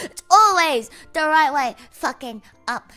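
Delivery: slow loud emphatic rant. Real, not acted. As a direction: pound it out. Ranting about USB C.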